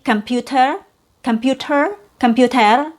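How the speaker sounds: "computer" with a true t and rising tune, endings varied; different accent. Shown as a demonstration. Teacher listing other accents' versions of "computer", true T. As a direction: sound the t in "computer", as in a non-American accent. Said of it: "in a different accent".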